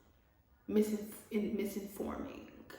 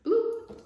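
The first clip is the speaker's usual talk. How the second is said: high bloop sound; 9 dB louder than usual. Acted. Sound effect word.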